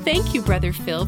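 ordinary talk

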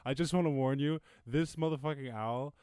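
normal talking voice

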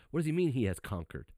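high-pitched, emphatic sermon delivery; impassioned preaching. Real, not acted. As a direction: drive each declaration home like a preacher. Preaches on the conquering Lamb.

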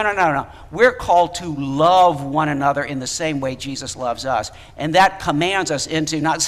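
normal talking voice

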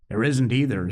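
ordinary delivery, nothing marked

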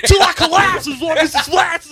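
bad [name] impression. Friend imitates [name] rapping, guttural and odd. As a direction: mangle an [name] lyric in a strange accent. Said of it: "That sounded so much like your James Corden impression".

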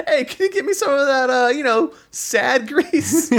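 high Brooklyn Italian ask; Brooklyn accent. Acted. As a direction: demand it like a Brooklyn guy. Brooklyn guy voice again.

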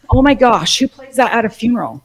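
loud, high disbelieving exclamation; scandalized laugh-edged outcry at a funeral song; incredulous. Real, not acted. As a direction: blurt it out in shocked disbelief.

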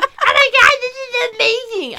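high-pitched, excited Cartman-style voice; Richard. Retold or imitated. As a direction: put on a squeaky, gushing cartoon voice. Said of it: "I love your voice for Richard".

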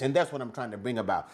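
ordinary delivery

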